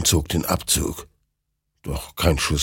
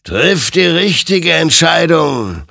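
slow, higher, louder ghostly warning; admonishing; 11 semitones higher, 7 dB louder than usual. A narrator's reading. Rider admonishes Hector with the gun, slow and grave. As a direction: warn him gravely like a spectral rider. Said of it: "ermahnte ihn sein Gegenüber".

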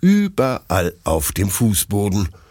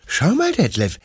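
very high little dog voice, showing off; proud, excited puppy. Acted. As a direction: gush like a delighted pup. Puppy proudly shows his clouds.

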